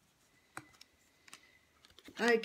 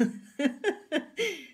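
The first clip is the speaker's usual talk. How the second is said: loud, high real laugh at her own slip; burst of laughter; 8 semitones higher, 4 dB louder than usual. Real, not acted. Laughing at her own tongue slip, loud laugh. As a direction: crack up laughing.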